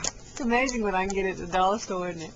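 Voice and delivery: ordinary delivery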